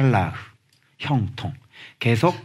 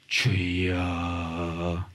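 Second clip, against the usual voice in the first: much lower, softer, drawn-out groan; mock sigh prayer; 8 semitones lower, 9 dB quieter, much slower than usual. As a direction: moan it like a worried prayer.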